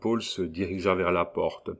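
ordinary delivery

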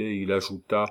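ordinary delivery, nothing marked

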